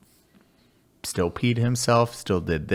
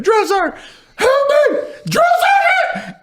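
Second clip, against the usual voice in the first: high, loud mock panicked yelling; panicked scream; 7 dB louder, faster than usual. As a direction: shriek for help like you're about to fall. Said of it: "So he's like".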